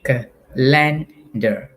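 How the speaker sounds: calendar said very slowly, cal-en-dar, beat by beat; syllables broken up. Shown as a demonstration. Teacher to students, demonstrating syllable breaking on calendar. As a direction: break calendar apart, one beat at a time. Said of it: "This is how you do it".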